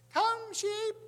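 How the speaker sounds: put-on very high, loud little-girl squeak; little squeaky voice. Little girl calling the sheep from the porch, squeaky. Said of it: "this little girl with all her little squeaky voices".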